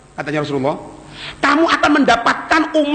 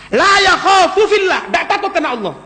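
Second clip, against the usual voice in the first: fiery preaching; 6 semitones higher than usual. Real, not acted. Preaching at full force.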